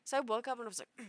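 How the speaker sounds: ordinary talk, nothing marked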